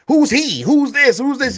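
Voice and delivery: high, insistent mock nagging; high rapid-fire retold questions; pestering questions. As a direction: imitate a wife who keeps on asking. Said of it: "And keep asking him, keep asking him".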